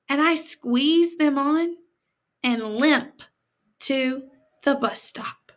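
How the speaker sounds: put-on reading a picture book to kids; gentle read-aloud for children; story reading. A narrator's reading. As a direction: tell it like a storybook to a class.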